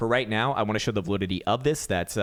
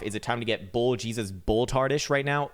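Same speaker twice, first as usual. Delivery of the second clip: usual speaking voice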